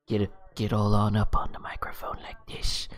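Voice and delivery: unvoiced close-mic whisper. Shown as a demonstration. GM shows players his quiet mic voice, whispering. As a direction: lean right into the mic and whisper. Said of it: "a little quieter and a little more int".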